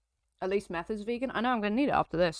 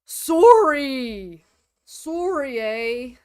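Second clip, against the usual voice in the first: loud mock Yorkshire "Sorry"; a hint of Yorkshire, drawn out; Yorkshire accent; 3 semitones higher, 7 dB louder, much slower than usual. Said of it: "went Yorkshire".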